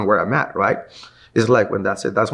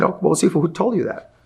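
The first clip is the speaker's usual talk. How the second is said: higher, playing innocent to the teacher; mock innocent; 9 semitones higher than usual. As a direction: act like you did nothing wrong. Feigning innocence to his teacher.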